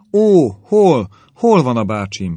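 high, loud cry of alarm, breaking out; sudden frantic high call. A narrator's reading. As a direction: leap up crying out for her uncle. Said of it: "kiáltotta Elizabeth felugorva".

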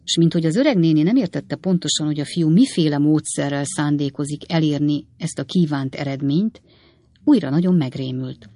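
ordinary delivery, nothing marked

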